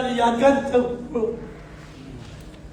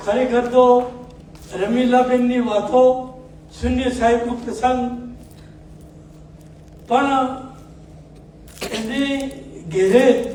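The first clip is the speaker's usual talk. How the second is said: ordinary talk, nothing marked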